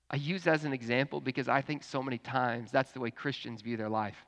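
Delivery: normal talking voice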